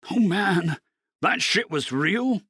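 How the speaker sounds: slow, high amazed question; stunned disbelief. Acted. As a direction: let the shock sink in. Dean finds the coins.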